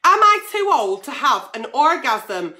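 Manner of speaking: normal talking voice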